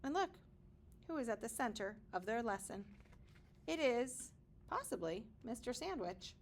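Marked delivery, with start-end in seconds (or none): none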